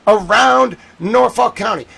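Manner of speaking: ordinary talk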